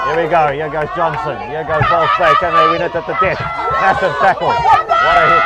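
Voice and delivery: loud rising live commentary; excited play-by-play. Real, not acted. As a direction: call the last attack, building loud. Live play-by-play of final attack.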